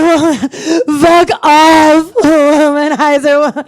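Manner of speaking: loud, high belted outburst; hammed-up screamed brush-off; running and screaming. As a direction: yell it over your own chant. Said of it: "running and screaming".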